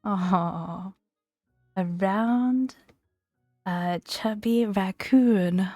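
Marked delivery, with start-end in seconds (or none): none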